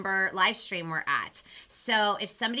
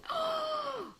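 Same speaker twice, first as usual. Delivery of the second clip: excited gasp; 5 dB quieter than usual. Real, not acted. Reacts to live stream count.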